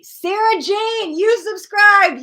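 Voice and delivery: high, loud, drawn-out announcement; big, slow, high-pitched shout-out; excited shout-out. Real, not acted. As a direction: call out a winner's name with excitement. Host calls out new subscriber.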